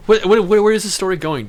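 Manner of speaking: demanding where this is going, loud and exasperated; exasperated shout. Retold or imitated. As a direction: blurt it out, fed up with the rambling. Exasperated at rambling friend.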